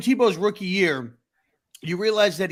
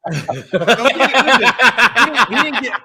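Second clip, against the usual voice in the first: laughing; 4 semitones higher, 7 dB louder than usual. Real, not acted. Laughing at the pause joke.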